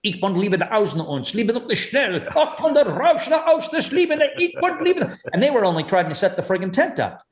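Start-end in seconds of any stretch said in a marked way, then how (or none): 0.0-5.2 hammed-up fake German gibberish, rising higher while laughing; mock German accent. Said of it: "with their accent will scare anything".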